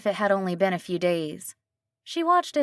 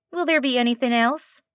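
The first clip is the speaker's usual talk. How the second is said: a light nasal rural twang, higher; a friendly diner waitress with a slight twang; a hint of a nasal twang; 5 semitones higher than usual. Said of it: "slight twang to it, more nasally than a southern accent".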